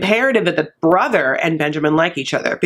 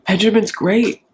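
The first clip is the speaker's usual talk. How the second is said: louder, higher, eager sell of the groom; mock gushing praise; 3 semitones higher, 4 dB louder, slower than usual. Brother pitching Benjamin to the dad, mock eager gush. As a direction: hype him up like a proud salesman. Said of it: "Or if it's like".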